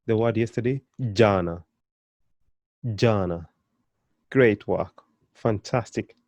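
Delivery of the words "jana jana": jana with an emphatic j; jana, firm j at the start; hard J. Shown as a demonstration. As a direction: press a little on the J in jana. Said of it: "they have a bit of emphasis on them".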